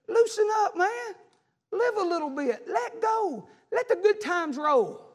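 high, lazy mock party voice; a carefree partier urging him to let go; mocking worldly voice. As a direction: sing out the world's easy advice.

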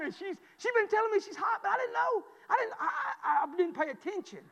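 high, loud, laughing through the words; can't get it out for laughing; real laughter breaking up the story. Real, not acted. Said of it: "I got busted out laughing".

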